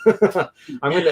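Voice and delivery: usual speaking voice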